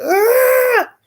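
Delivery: very high, loud, slow squeal; disgusted kid squeal. Retold or imitated. Classmates mocking his snotty hair, high squeal. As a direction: drag it out like shrieking grossed-out kids. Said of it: "they was like".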